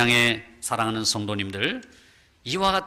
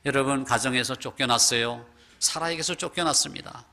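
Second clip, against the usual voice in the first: ordinary delivery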